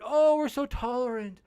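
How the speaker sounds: high, sarcastic mock-tolerant voice; sneering impression of a self-righteous critic; mocking singsong.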